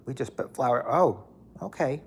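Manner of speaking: normal talking voice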